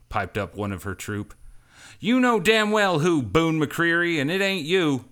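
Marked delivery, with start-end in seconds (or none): 2.0-5.0 loud, much higher shout over the shoulder. A narrator's reading. Cash shouts back at her crew member, loud and high. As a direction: yell back at the heckler. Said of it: "Cash shouted".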